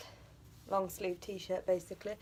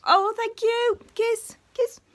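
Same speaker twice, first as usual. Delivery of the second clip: high and louder, cooing thanks; delighted pet talk; 13 semitones higher, 5 dB louder, much slower than usual. Real, not acted. Owner thanking her bird for a kiss, high delighted cooing.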